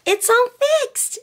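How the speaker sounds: put-on very high, slow, delighted child; little girl Lisa. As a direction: sing it out like a thrilled little girl. Lisa voiced by reader.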